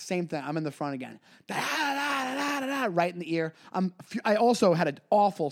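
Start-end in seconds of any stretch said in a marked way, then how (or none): 1.5-2.9 mock chatter. Mimicking host's nonstop chatter.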